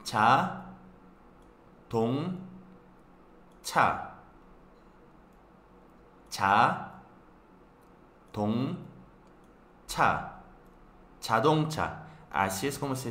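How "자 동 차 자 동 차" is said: "자, 동, 차" separado y claro; sílaba por sílaba. Shown as a demonstration. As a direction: corta cada sílaba de "자동차". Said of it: "así es como se pronuncia". (pronuncia "자", "동", "차" por separado)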